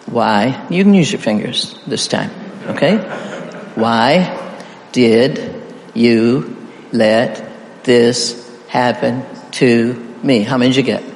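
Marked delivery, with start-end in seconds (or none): 3.7-10.4 very slow, a pause after every word; spaced-out, deliberate single words for counting. Shown as a demonstration. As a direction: count out each word separately. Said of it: "Count the words".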